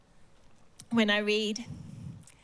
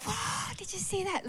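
high, amazed gasp at a shooting star; mock excitement; 10 semitones higher, 4 dB quieter than usual. Preacher imitating herself spotting a shooting star, high and amazed. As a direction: gasp it out like you just saw a shooting star. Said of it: "I get excited if I see a shooting star".

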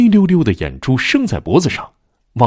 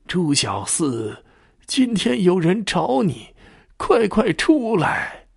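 very slow, drawn-out, higher ghostly calling; haunting graveyard summons, stretched long; eerie slow ghost call; 10 semitones higher, much slower than usual. Acted. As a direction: draw each word out like a spirit summoning the dead. Ghostly jailer calls at grave.

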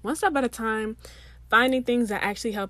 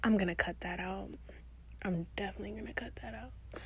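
whispered aside; hushed whispered aside; 9 dB quieter than usual. Real, not acted. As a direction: mutter it as a note to herself. Aside to herself.

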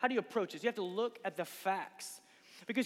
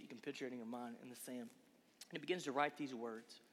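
softer and lower, drawing listeners in close; hushed storytelling; 5 semitones lower, 10 dB quieter than usual. Acted. Preacher to youth, picturing Jesus in the sand, hushed. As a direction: drop to a soft, low hush as he paints the scene.

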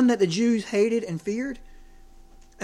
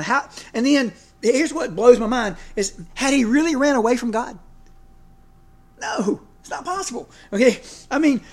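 raised, louder preaching voice; 5 semitones higher, 4 dB louder than usual. Real, not acted. Preacher pressing his own story on the congregation, louder. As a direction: press the point, rising in force.

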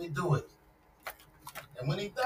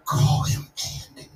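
crew correcting; 6 dB louder, slower than usual. Retold or imitated. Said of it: "everyone said".

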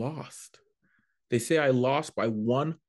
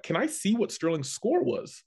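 lightly voiced promoter; 9 semitones higher than usual. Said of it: "John Fox was like".

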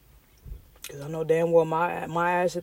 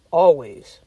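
emphatic shout; 11 dB louder than usual. Real, not acted. Emphatic affirmation.

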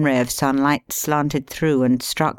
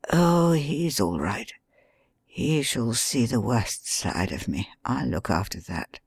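softer, slow wounded detective slipping into whispers; hushed bedridden plotting; weak injured man; 3 semitones lower, 6 dB quieter than usual. Acted. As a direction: keep it low and faint, conserving strength. Injured Holmes, faint voice.

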